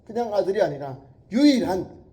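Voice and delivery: loud, high, building emphasis; preaching at full force. Acted. Preacher building to the one and only Son, loud. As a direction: climb toward the only Son.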